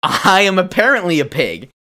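lightly hammed-up pig declaring himself; pig speaking aloud. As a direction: announce it out loud, playfully theatrical. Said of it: "He says it out loud".

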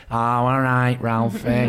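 flat northern vowels, Craig's voice; broad flat Mancunian vowels. Retold or imitated. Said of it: "the flat vowels".

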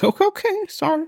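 very high, small squeaky apology; sheepish apology. Retold or imitated. Embarrassed customer apologizing to the mumbling seller, squeaky. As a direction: back off in a squeak.

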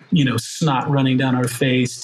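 ordinary talk, nothing marked

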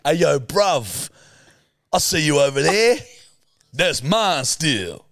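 slow, hammed-up London bruv accent; mocking London roadman accent. Teasing a co-host's gym bro, mock London accent. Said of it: "forty-four accents in one".

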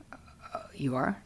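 slow hushed disbelieving whisper; surprised whisper. Retold or imitated. Boyfriend hearing she's going to AA, slow whisper. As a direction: breathe it out, caught off guard.

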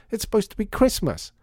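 high, aggrieved plea; child's protest. A narrator's reading. Lydia pleading for a proper Christmas, aggrieved.

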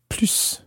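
plus with the final S sounded; S pronounced. Shown as a demonstration. Teacher introduces the interesting word, final S sounded in "plus". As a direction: say "plus" ending in a clear hiss of S. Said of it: "there's a difference between plus".

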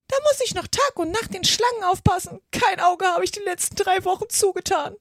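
high-pitched bird lament; aggrieved pigeon. A narrator's reading. Pigeon lamenting sleepless nights guarding eggs, aggrieved. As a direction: pour out the woes of a worn-out pigeon.

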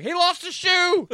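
high, loud, drawn-out announcement; imitated holler. Old worker stops the ride, imitated holler. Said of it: "He was like".

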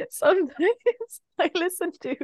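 giggling, high and sheepish; embarrassed laugh. Real, not acted. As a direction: laugh through the confession.

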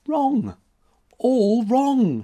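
high, loud, forceful protest; all wrong, with passion; vehement cry. A narrator's reading. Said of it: "she cried vehemently".

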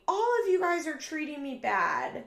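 high, loud mock yell; retold scream. Roommate accusing everyone of mistreating her, mock screaming. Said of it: "Janelle starts screaming".

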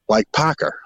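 "Parker" with an added R at the end; Boston accent. Shown as a demonstration. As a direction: say "Parker" for parka, final R added Boston style. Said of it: "add an R to anything that ends in an A".

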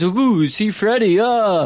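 high, loud, drawn-out spooky "ooh"; mock jump scare. Mocking a cheap jump scare.

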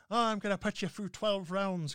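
badly faked Irish actor's threat; bad Irish accent. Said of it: "I can't do an Irish accent".

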